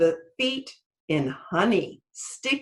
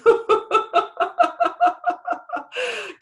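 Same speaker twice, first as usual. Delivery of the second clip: high, long rolling laugh; hearty laugh; 4 dB louder than usual. Acted. Sky god laughing as Anansi leaves, hearty laugh. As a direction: laugh like a god amused by a trick.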